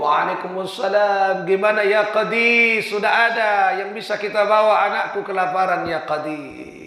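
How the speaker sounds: high, pleading voice, loud; desperate begging father. Acted. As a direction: beg for your hungry child. Father begs for food. (ayah memohon dengan suara tinggi)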